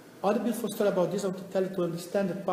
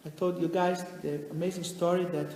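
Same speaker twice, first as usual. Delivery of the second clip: usual speaking voice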